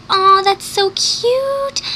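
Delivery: high, slow and swooning; gushing aw. Retold or imitated. Girl cooing at a cuddling couple on screen, swooning. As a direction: coo over the cuddling couple. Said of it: "I was like".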